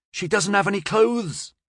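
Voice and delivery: high-pitched whining protest; whiny. A narrator's reading. Florian defending taking the sewing kit, whiny. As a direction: whine it as an objection. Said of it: "hating how whiny he sounded".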